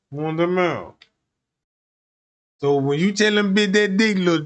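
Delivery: ordinary talk, nothing marked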